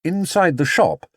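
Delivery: ordinary delivery, nothing marked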